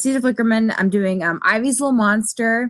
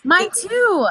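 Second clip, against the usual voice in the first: excited agreement; 12 semitones higher than usual. Real, not acted. Delighted at a shared first cosplay.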